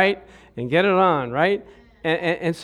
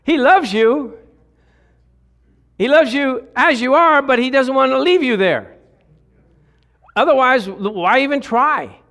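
passionate preaching; 6 semitones higher, 4 dB louder than usual. Real, not acted. Pastor preaching with force.

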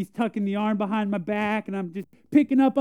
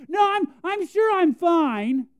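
higher, drawn-out mock-brave protest; forced reassurance; 9 semitones higher, 4 dB louder, slower than usual. Boy telling grandfather his hurt finger is fine, forced reassurance. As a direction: insist you're fine, pitched up and slow.

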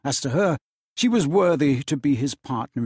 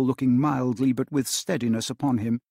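usual speaking voice

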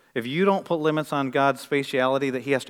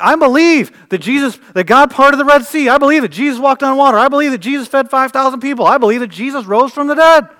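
mock proclaiming; 10 semitones higher, 11 dB louder than usual. Mocking big-box believers.

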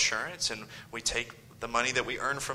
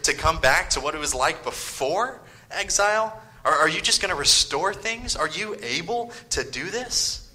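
higher, mocking questions thrown at the exiles; scornful taunting; 5 semitones higher, 5 dB louder than usual. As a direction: taunt them like the enemy would. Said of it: "He, he raises up".

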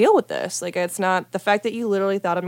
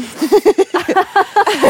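loud high laugh; 12 semitones higher, 6 dB louder than usual. Real, not acted. Laughing at her own story.

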